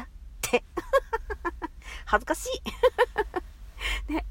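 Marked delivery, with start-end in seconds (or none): 0.7-2.0 恥ずかしそうに高い声で息まじりに笑う; 照れてくすっと笑う; 照れ笑い. Real, not acted. 2.5-4.0 恥ずかしそうに高い声で息まじりに笑う; 照れてくすっと笑う; 照れ笑い. Real, not acted.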